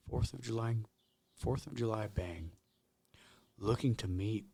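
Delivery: hushed, low public-radio read; NPR voice. Pretend. Co-host reading a Craigslist ad aloud, hushed NPR voice. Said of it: "get my N P R voice going".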